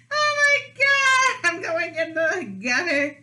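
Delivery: real laughing shriek at the mess; excited shriek. Real, not acted. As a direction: squeal it as it all spills over. Cream spilling, shrieks in laughter.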